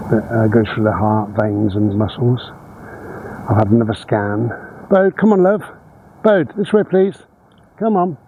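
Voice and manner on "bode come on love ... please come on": high sing-song call to the dog. Real, not acted. Owner calling her dog Bode along the path, high coaxing call. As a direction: coax the dog over, pitched well up.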